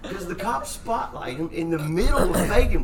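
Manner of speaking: high, loud, slowed words pushed through laughter; dying laughing. Real, not acted. Friend reliving the underwear arrest, high loud laughter. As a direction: laugh so hard the words barely get out. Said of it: "fucking dying laughing".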